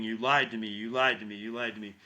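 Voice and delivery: normal talking voice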